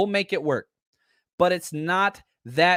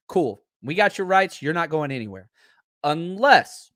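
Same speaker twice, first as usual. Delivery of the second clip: ordinary talk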